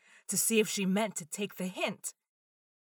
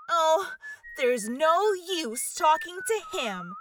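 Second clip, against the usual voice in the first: desperate; 7 semitones higher, 4 dB louder, slower than usual. A narrator's reading. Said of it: "said Alice desperately".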